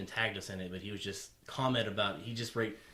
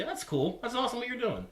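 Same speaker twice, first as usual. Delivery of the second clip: high, bright excited praise; upbeat praise; 13 semitones higher than usual. Retold or imitated. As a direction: gush it, way up high. Cheerful supportive friend quoted.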